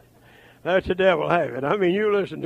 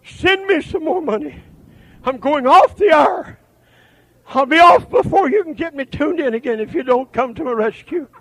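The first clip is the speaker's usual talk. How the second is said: a hint of a pleading radio preacher, loud; mock radio begging; 11 semitones higher, 7 dB louder than usual. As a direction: sneer at the radio beggar's plea. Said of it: "but listen to this".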